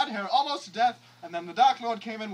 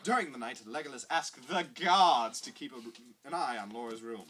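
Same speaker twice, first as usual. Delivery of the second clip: ordinary delivery, nothing marked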